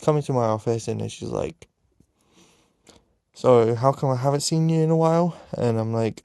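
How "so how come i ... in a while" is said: higher, louder mimicked questioning; acted inquiry, pitched up and projected; imitated probing question. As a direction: play a support worker asking after someone. Retold support worker questions student.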